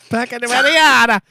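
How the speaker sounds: slow, high, hammed-up Greek fruiterer saying Pecco Bagnaia; mock Greek fruiterer. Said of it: "Feels like something a Greek fruiterer".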